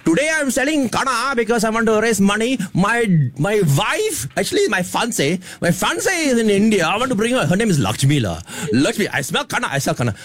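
high Indian-accented kana seller. Shown as a demonstration. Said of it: "Mudu, who is a kana seller".

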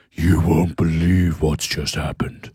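low, slow, sassy drag queen drawl. Retold or imitated. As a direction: camp it up like a deep-voiced diva. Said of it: "it's her drag queen voice".

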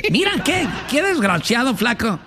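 high, laughing mock outrage; laughing tease. Real, not acted. Host laughing at flaco's trick, mock outrage. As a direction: tease him while laughing.

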